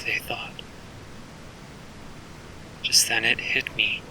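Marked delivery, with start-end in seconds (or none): none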